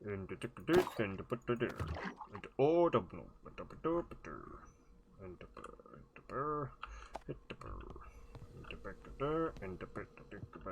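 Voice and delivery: soft mock-Swedish gibberish, sung-spoken to oneself; Swedish Chef voice. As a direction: potter along in quiet comic Swedish babble. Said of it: "in the Swedish Chef voice".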